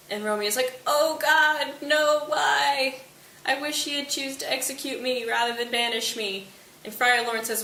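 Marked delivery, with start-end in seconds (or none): 0.9-6.5 mock wailing Romeo. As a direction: bewail your fate, hammed up. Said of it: "crying and wailing and rolling around".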